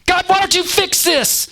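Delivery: very high, strained wailing complaint; high-pitched, accusing outburst; crying out. Acted. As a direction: nag God in a high, desperate cry. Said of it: "why they cry out in the way that they do".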